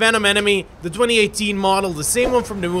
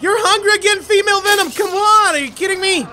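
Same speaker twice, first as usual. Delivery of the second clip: exasperated; 9 semitones higher, faster than usual. Acted. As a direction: sigh at the endless hunger, pitched up. Exasperated at the hungry character.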